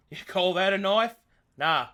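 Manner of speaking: loud Aussie tough-guy quote; Australian accent. Acted. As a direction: do a broad Australian film-hero line. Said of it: "The legend of the Aussie Metsus".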